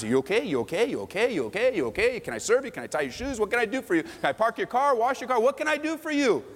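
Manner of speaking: rising, eager offers of help; eager servant voice. Retold or imitated. Churchgoers rushing to serve each other, eagerly. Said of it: "we'd be all asking".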